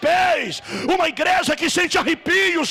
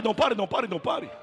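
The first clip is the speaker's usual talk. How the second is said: dropping lower and softer to almost nothing; fading urge; 4 semitones lower, 6 dB quieter, much faster than usual. Real, not acted. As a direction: let the plea fade away to a murmur. Urges the church to keep worshipping. (pedindo que não parem, a voz some até quase nada)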